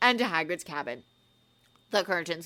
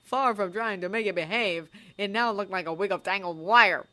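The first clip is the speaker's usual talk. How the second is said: ordinary delivery, nothing marked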